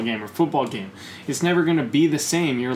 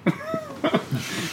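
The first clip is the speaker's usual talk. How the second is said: laughing; 5 dB quieter than usual. Real, not acted. Laughs at his own rant.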